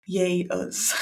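dry sarcastic cheer; deadpan, mock celebration. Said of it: "Sense of sarcasm there".

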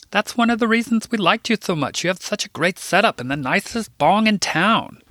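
high, fawning big-man voice, pitched well up; a high, syrupy, fawning Philip. Acted. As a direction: gush like a sweet-talking dealer.

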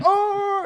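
high loud hammed-up oh of pain; dramatic fake yelp. Opposing player faking a foul with a yelp. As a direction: flop like you've been fouled. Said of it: "the most dramatic thing".

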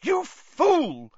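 very high outraged cry; sharp high-pitched rebuke. A narrator's reading. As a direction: cry out in anger. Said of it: "Professor McGonagall cried".

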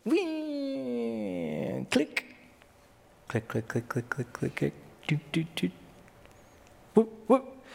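high playful wee then quiet clicks; reel sound effects. Retold or imitated. Preacher acting out Jesus casting a line, playful noises. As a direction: mimic casting and reeling in.

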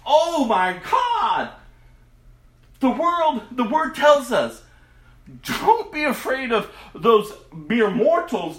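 preacher rising to full force; impassioned preaching. Real, not acted. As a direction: cry it out from the pulpit.

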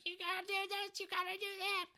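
very high-pitched mock nagging mom; screechy parody of a bossy mother; imitating bossy mom. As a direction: order him around in a high put-on voice. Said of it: "She's like".

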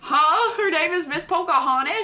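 high, loud double take; incredulous. Real, not acted. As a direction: blurt it out in high-pitched disbelief. Startled by teacher's odd name.

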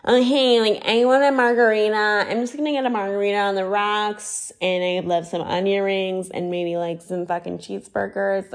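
hammed-up classic American diner order; mock American. American tourists ordering from a Rome waiter, mock American accent. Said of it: "so classic American that they're just like".

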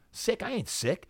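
high-pitched, disbelieving comeback; retold incredulous protest. Retold protest at being called sick.

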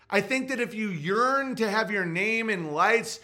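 usual speaking voice

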